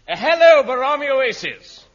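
very high, loud hailing shout; hammed-up calling out to someone far away; long-distance call. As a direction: holler across a great distance. Calling agent in distant oasis.

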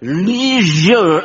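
"Leisure" drawn out as LEEE-zhuh, high; strained American attempt. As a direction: overdo the long ee in "Leisure". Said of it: "I can't do it".

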